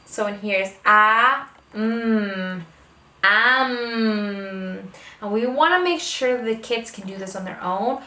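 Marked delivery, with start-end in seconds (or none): 1.7-4.9 "mm" hummed, then "am" blended slowly; sounding out. Shown as a demonstration. Parent sounding out am with a child, drawn out. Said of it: "we make that sound together".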